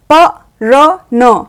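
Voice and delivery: very slow, each letter apart; letters read one by one. Shown as a demonstration. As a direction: spell out प र न slowly. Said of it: "अब ये आधा प और पूरा र से क्या बन गया?".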